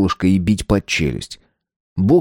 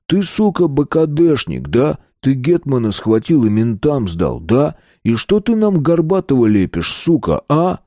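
high-pitched, needling interrogation; angry accusation; 6 semitones higher than usual. A narrator's reading. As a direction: accuse and demand an answer, pitched up. Bulldog accuses hero of betrayal.

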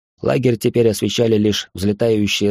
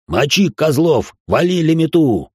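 battle cry; 7 semitones higher, 4 dB louder, slower than usual. A narrator's reading.

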